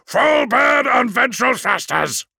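loud, high, drawn-out command; full-voiced battle shout; shouted order. A narrator's reading. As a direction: bellow it to the engine crew. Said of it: "shouted Wayland".

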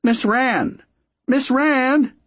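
high, loud calling out; crying out. Acted. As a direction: call across the room urgently. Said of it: "Jaspers finally cried out".

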